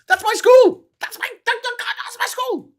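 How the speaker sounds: very high, loud excited squeal. Retold or imitated. Liverpudlian spotting their old school on TV, excited squeal. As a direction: squeal it, tripping over the words.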